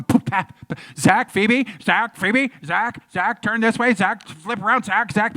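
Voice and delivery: mock paparazzi shouts, high and urgent; photographers barking names. Photographers calling actors on the red carpet, barking shouts. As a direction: bark the names over flashbulb pops. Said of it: "People shout, barking at you".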